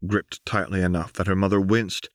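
usual speaking voice

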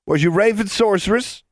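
put-on very high-pitched taunting boy. A narrator's reading. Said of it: "taunted Benjamin".

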